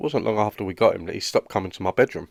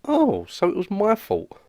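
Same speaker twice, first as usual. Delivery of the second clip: indignant surprise; 9 semitones higher, slower than usual. Acted. Indignant realisation.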